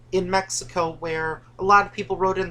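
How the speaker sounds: ordinary talk, nothing marked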